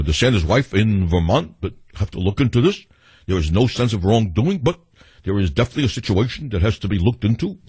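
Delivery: put-on a Brooklyn-accented old senator hedging his words; a hammed-up New York accent, stammering and repeating itself; Bernie Sanders impression. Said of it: "I like the New York accent".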